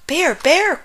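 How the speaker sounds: high, eager call. A narrator's reading. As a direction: call for a friend's attention, pitched high. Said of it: "called Frog".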